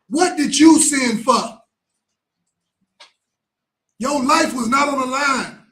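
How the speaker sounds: angry rebuke. Acted. Preacher condemning the sinning coward, loud and scolding. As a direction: hammer the coward with each line.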